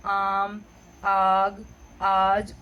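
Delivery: आम, आग, आज with the opening आ stretched long and open; long aa sound demo. Shown as a demonstration. Teacher shows students the long आ in आम, आग, आज. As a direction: stretch the आ at the start of each of आम, आग, आज. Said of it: "जो आ वाली sound है जैसे कि".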